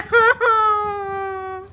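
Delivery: long, high fake cry; drawn-out pretend sob; hammed-up wail of despair; mock sobbing wail. Mock lament ends in wail.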